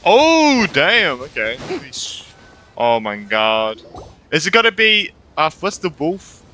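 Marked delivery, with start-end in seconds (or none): none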